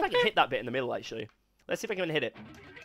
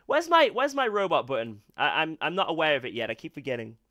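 ordinary talk

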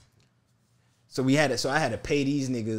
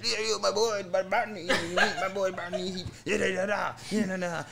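imitating a heavy Mexican accent, pitched up; mock Mexican accent, higher voice; 6 semitones higher than usual. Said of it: "they saying all this with an accent".

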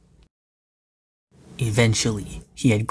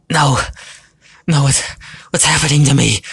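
high, loud, broken-up panic; panicked; 5 semitones higher, 5 dB louder than usual. Acted. Ratik starts shrinking, crying out in panic. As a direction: cry out, stumbling in alarm.

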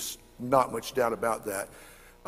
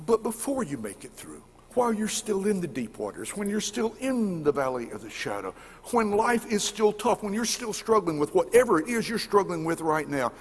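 rising higher and louder as it builds; building sermon; 7 semitones higher, 3 dB louder than usual. Acted. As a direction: climb toward the point. Preaching builds up.

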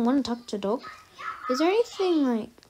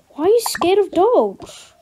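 surprised parent; 6 semitones higher, 6 dB louder than usual. Acted. Parent surprised daughter fears dogs.

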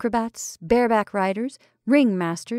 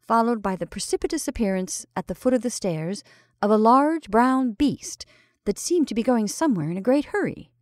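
usual speaking voice

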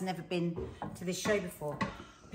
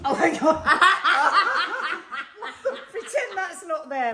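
laughing; 8 semitones higher, 9 dB louder than usual. Real, not acted. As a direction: laugh through the words. Laughing at mishap.

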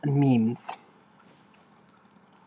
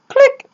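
mock click. Voiced phone hang-up.

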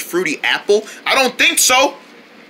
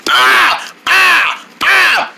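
startled screams; 8 semitones higher, 3 dB louder, much slower than usual. Acted.

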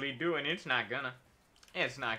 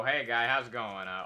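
normal talking voice

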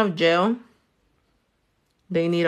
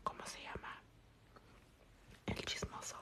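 hushed, voiceless whisper; 32 dB quieter, much faster than usual. Real, not acted. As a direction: whisper it under your breath. Trying to recall name under breath.

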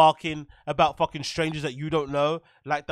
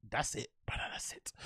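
whisper; 7 semitones lower, 16 dB quieter, slower than usual. Real, not acted. Trailing off to a whisper.

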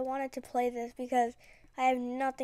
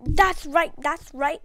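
triumphant; 3 semitones higher, 8 dB louder, slower than usual. Real, not acted. Gamer gloating to viewers after the kill, loud and triumphant. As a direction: gloat over the win. Celebrating kill, louder repeat.

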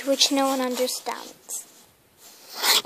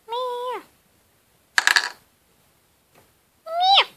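much higher little meows; kitten meows; 9 semitones higher than usual. Pretend. Kitten answering the owner, high meows.

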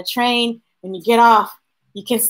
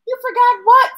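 calling back; 13 semitones higher, 4 dB louder than usual. Acted. Papa asks from another room.

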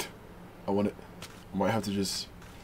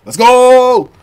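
loud happy cheer; 4 semitones higher, 7 dB louder than usual. Real, not acted. Gamer celebrating a catch, loud cheer.

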